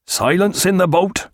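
high, mildly shocked order; shocked cry. A narrator's reading. Coxswain hushing the boat, shocked and high. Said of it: "cried the coxswain in a shocked voice".

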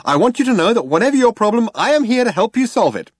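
high, loud detergent-advert pitch; brash and cheery. A narrator's reading. Computer offers Zaphod help, brash and cheery. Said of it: "brash and cheery, as if it was selling detergent".

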